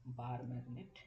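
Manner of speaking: usual speaking voice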